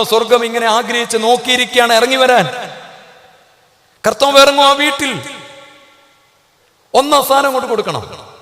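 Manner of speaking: high, loud fervent preaching; rising fervour of a revival sermon; impassioned preaching. Acted. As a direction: preach it out to the whole church. Preaching at full force.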